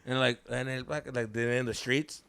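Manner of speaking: usual speaking voice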